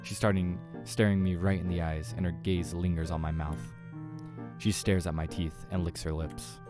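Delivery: ordinary delivery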